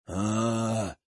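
low, dim droning "aaa"; dull drone. Acted. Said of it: "прогудел туповатый Спарки".

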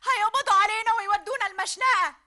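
high, loud, tearful; panicked wail. Acted. Sabah panicking that they will hang. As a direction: near crying with terror.

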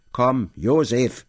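loud, slow call to Josef. A narrator's reading. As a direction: summon him across the room, slowly and loudly. Uncle calls to K.

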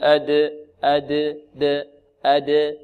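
slow أد with a neutral echo; bounced dal. Shown as a demonstration. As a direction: bounce the d of أد with no vowel. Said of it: "بل".

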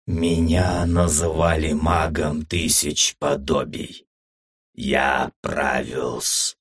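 put-on slow, low, drawn-out old mage; an ancient voice intoning slowly, word by word; strange old man's voice. A narrator's reading. Old mage speaking inside the hero's head, strange old man's voice. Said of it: "едва слышный старческий голос".

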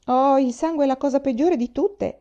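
put-on boy exclaiming about blood, mildly horrified. A narrator's reading. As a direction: burst out in horror. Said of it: "esclamò Walter".

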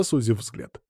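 ordinary delivery, nothing marked

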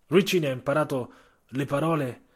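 ordinary delivery

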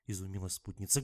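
plain reading voice

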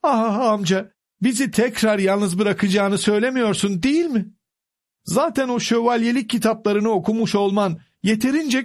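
high, pleading niece on the edge of tears; holding back tears. A narrator's reading. Niece begging her uncle not to leave, tearful. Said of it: "gözyaşlarını tutmaya çalışırken dudakları titriyordu".

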